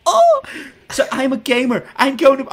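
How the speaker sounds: high, loud, hammed-up American voice; put-on American accent. Mocking fake gamers at an awards show, hammed-up American accent. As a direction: slide from ranting into squealing fake American. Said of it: "I keep doing an American accent".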